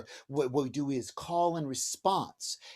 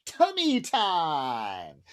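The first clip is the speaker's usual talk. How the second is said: slow, sing-song "tummy time"; drawn-out tummy time; 4 dB louder, much slower than usual. Shown as a demonstration. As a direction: stretch "tummy time" out playfully. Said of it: "what we like to call".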